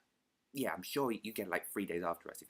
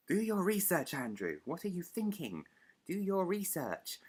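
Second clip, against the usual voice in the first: high, exasperated self-telling-off; mock scolding; 10 semitones higher, 4 dB louder than usual. Vlogger telling himself off over a forgotten name, mock scolding. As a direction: scold yourself in mock outrage, pitched up.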